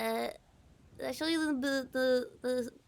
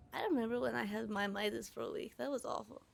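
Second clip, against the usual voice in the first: ordinary delivery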